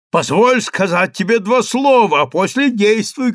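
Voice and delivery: high, insistent plea; urgent pleading. A narrator's reading. Chief rabbi begs Daniil for two words, urgent pleading.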